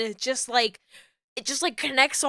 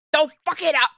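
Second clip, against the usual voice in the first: loud, higher self-scolding; mock shout of warning to yourself; yelling at oneself; 5 semitones higher, 5 dB louder, faster than usual. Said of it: "That's me at myself".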